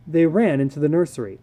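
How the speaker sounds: plain reading voice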